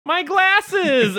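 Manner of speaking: high, loud cartoon wail over lost glasses; Velma's loud cry. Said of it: "And Velma loudly goes".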